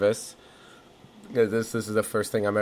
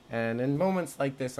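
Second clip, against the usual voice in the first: normal talking voice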